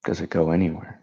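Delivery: calm meditation guide, long pauses between phrases; measured, unhurried meditation voice; guided meditation. Acted. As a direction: lead them gently inward, leaving silence after each phrase.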